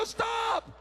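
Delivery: high-pitched mock yell of alarm; retold yell. Dad telling injured son to stop pulling his arm, mock yell. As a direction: yelp it like a panicked parent, acted out. Father retells yelling at son.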